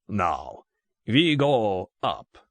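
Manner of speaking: put-on slow, drawn-out thick Russian accent; good-humoured Russian accent. A narrator's reading. Russian gunman directs captive Bond uphill, good-humoured thick Russian accent. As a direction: spell out the plan, heavily Russian-accented. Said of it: "in a tone of good-humoured explanation".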